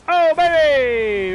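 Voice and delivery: very loud, high, drawn-out cry; ecstatic shout. Real, not acted. Gamer celebrating a big play, ecstatic shout. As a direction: let the hype burst out.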